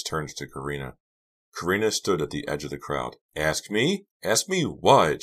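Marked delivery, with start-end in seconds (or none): 3.4-5.2 sharp rise in pitch; indignant demand. A narrator's reading. Karina challenges Delia before the crowd, indignant demand. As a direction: demand it, higher and affronted. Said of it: "she demanded".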